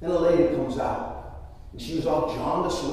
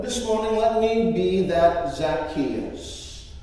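normal talking voice

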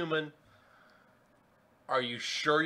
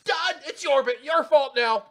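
higher and louder gloating snap; triumphant Red Queen; 9 semitones higher, 11 dB louder than usual. Acted. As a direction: fling the blame away triumphantly. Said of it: "The Red Queen goes".

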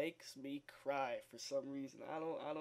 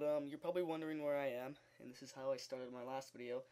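normal talking voice

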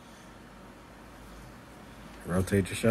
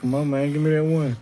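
loud encouragement; 9 semitones higher, 8 dB louder than usual. Real, not acted. Urging last push up.

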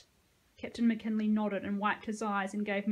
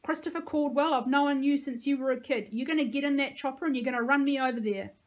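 raised, bossy telling-off; higher, louder reprimand; scolding; 4 semitones higher, 5 dB louder than usual. A narrator's reading. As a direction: scold him like someone who's known him since childhood. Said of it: "heard her scolding".

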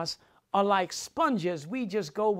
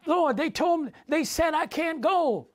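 higher, complaining excuse voice; mock protest, pitched up; whiny excuse to the Lord; 6 semitones higher than usual. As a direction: play a believer whining to God.

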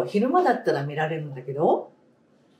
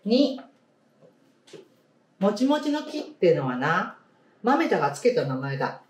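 story reading; 2 semitones higher than usual. Acted. Teacher reading a picture book to the class, storybook voice. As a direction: clear storybook read-aloud voice.

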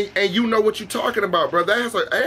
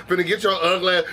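ordinary talk

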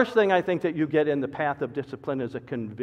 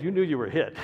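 ordinary talk

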